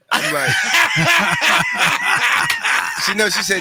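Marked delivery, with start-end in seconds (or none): 0.6-3.0 high, loud burst of laughter; real shocked laughing shriek; big shrieking laugh. Real, not acted. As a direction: explode with laughter. Shocked laugh at wife reveal.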